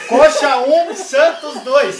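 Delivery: loud, high score announcement; loud announcer call. Acted.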